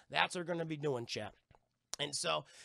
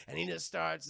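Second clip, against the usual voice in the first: normal talking voice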